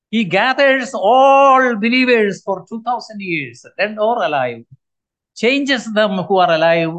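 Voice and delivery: usual speaking voice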